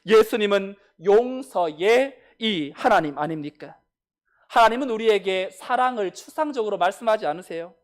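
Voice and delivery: ordinary delivery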